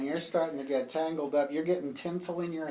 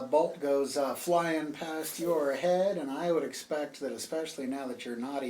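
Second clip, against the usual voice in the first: ordinary delivery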